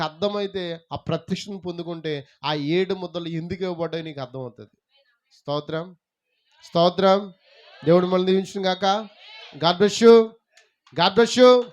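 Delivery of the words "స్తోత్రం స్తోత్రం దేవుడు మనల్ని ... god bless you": loud sermon-closing benediction; proclaimed blessing. Acted. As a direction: call the blessing out to everyone. (బిగ్గరగా దీవెన పలుకుతూ)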